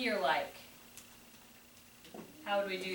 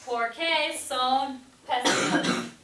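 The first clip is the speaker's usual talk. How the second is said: slow answer; 3 semitones higher, 4 dB louder, slower than usual. Shown as a demonstration. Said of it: "Wanna see the cool thing?".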